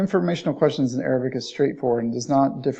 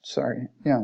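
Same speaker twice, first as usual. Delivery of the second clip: ordinary delivery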